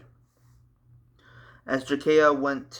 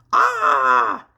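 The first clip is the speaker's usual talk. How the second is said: pained high scream; 8 dB louder than usual. Acted. Struck in the golden heart.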